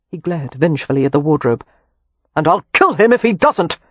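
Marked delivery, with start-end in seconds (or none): 2.3-3.8 louder, higher vengeful threat; angry threat. A narrator's reading. Leo vowing to kill Harrow, angry threat. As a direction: swear it through rage.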